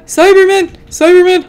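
startled alarm; startled shout. Real, not acted. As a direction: yell it in surprise. Surprised by enemy.

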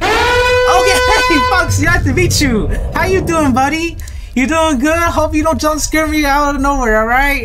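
high-pitched, over-friendly greeting; butter up the monster in a high, pleading voice; nervous sweet-talking. Pretend. Sweet-talking the approaching animatronic.

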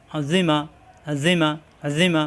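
Azima drawn out with a long final a; slow spelling drill; slow reading. Shown as a demonstration. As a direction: stretch it slowly first. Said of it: "তাড়াতাড়ি".

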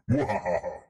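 sneering evil mwahaha laugh; villain cackle. Pretend. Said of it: "that's the Potato Overlord voice".

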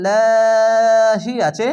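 লাহি chanted long and loud with thin lam; recited লাহি with light lam; recitation voice. Shown as a demonstration. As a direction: intone লাহি slowly. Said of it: "পাতলা করা হবে".

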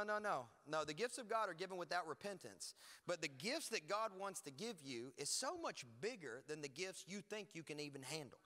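normal talking voice

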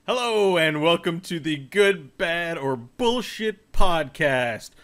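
slow announcer welcome; podcast host intro. Acted. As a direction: open the show like a host.

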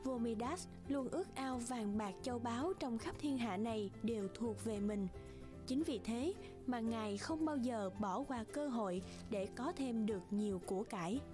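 plain reading voice

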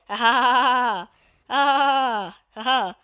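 mock evil laugh. Player boasting of an evil plan, mock evil laugh. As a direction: play at a villain's laugh, lightly. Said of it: "I'm evil, I'm evil".

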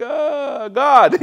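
high, halting then loud blurt; mock sheepish answer. Teen answering an angry mom, hammed-up sheepishness. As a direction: imitate a cornered teen blurting an excuse.

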